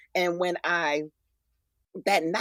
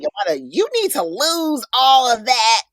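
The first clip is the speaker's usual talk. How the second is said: coach voice; 6 semitones higher, 10 dB louder than usual. Retold or imitated. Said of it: "he always tells me".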